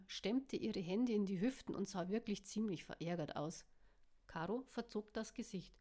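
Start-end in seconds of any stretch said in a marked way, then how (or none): none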